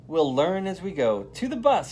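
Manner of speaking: rally the class onto the bus; upbeat teacher calling the class to go; cheerful teacher's call. A narrator's reading. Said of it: "says Miss Frizzle".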